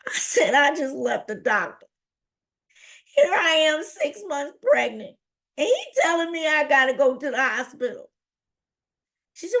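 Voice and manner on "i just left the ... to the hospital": high, whiny imitation of upset daughter; distraught complaint. Daughter telling her mother the news, distraught.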